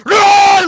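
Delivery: big, high, very loud beastly roar; primal roar. Shown as a demonstration. Showing how it should be yelled, primal roar. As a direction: roar it out with everything behind it. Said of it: "Like, man, get primal".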